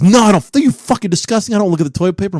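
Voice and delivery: loud, high-pitched mock outrage; indignant denial. Buddy's indignant denial acted out.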